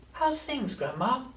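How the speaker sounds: high, light grandson voice; storybook boy's tentative question; George checking in. A narrator's reading. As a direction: ask it casually, pitched well up.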